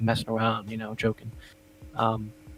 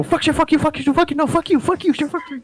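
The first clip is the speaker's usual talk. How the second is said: much higher, squeaky back-and-forth of curses; squeaky yelling match. Retold or imitated. Two people outside trading silly high-pitched insults. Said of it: "peek your head out the window".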